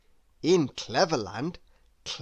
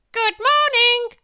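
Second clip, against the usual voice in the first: very high, loud machine greeting; a cheery gadget chiming hello; talking alarm clock voice, high and bright; chirpy alarm clock; 9 dB louder than usual. Acted.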